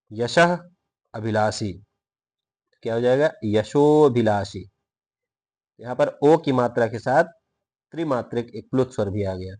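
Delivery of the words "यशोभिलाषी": यशोभिलाषी very slow, ओ held long; stretched pronunciation. Shown as a demonstration. Teacher modelling the next example, slow and drawn out. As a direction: draw out the ओ in यशोभिलाषी. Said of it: "लंबा खींच के पढ़ेंगे".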